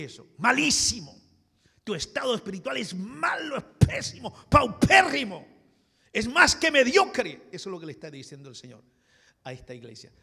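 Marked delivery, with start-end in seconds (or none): 0.4-7.5 high, very loud hammered-out condemnation; full-force preaching. Acted. Preacher telling the church its spiritual state is terrible, shouting. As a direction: pound each damning word at the congregation.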